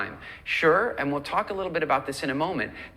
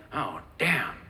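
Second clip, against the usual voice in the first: deflated food devil. Acted. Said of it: "The food devil's gonna go".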